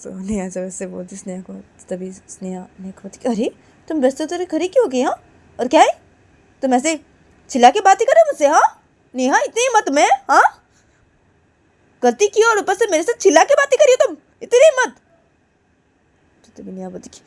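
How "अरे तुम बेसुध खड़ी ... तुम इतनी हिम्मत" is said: high, loud indignant scold; shrill how-dare-you; outraged scolding. Acted. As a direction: challenge her, voice up high. Sneha outraged at being shouted at.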